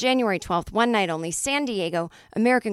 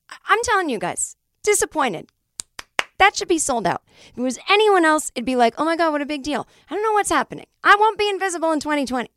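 higher, louder frustrated rant about unsold seats; real exasperation at empty seats; exasperated rant; 7 semitones higher, 3 dB louder than usual. Real, not acted. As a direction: vent the frustration, building up. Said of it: "This is the worst episode".

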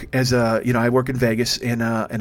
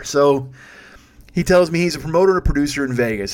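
usual speaking voice

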